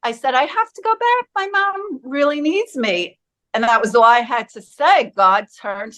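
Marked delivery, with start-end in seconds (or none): 0.3-3.2 high, small pleading voice; retold tearful plea. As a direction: mimic an upset child begging to go back. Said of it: "Why are you crying".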